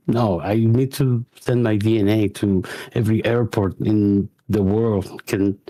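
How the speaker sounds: ordinary talk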